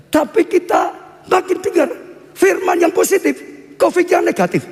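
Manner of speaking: high-pitched, punchy proclamation; emphatic preaching. Acted. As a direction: ring it out to the congregation. Preacher drives home his point.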